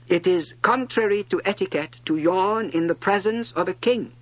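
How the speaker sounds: high, loud royal reproof; scolding monarch. A narrator's reading. King to the yawning prince, loudly scolding. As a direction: rebuke him as an offended king. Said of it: "the monarch said to him".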